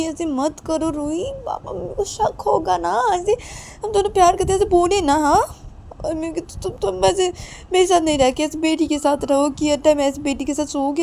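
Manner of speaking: high, insistent begging; husband urging his wife not to raise suspicion; pleading husband. Acted. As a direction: keep begging her, voice lifted.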